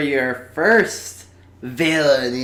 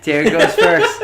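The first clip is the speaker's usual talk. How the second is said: laughing; 14 semitones higher, 6 dB louder than usual. Real, not acted. Mocking echo of the coin result.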